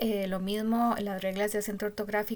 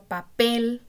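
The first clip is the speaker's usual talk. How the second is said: "papel" with "pel" punched louder; stress on last syllable; 3 semitones higher, 6 dB louder than usual. Shown as a demonstration. Teacher gives students an example, punching "pel" in "papel". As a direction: hit the final "pel" of "papel" hard. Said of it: "el acento está en la última sílaba".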